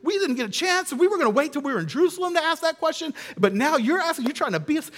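mock upset complaint. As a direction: complain like a jealous kid who got beaten to it. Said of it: "They were upset because".

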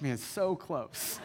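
slow, laughing letdown; laughing sigh. Retold or imitated. Pastor retelling the near miss to the church, slow and laughing. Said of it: "We're like".

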